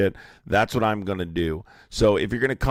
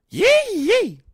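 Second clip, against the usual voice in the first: mock yee cry; 7 dB louder than usual. Mocking cry after insult.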